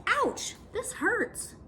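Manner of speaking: high pained yelp; a child yelping in pain. Acted. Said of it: "he yelped".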